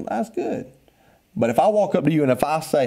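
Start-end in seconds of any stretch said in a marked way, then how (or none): 0.1-0.7 offhand reply. Retold or imitated. Dismissive reply to 'I'm good'.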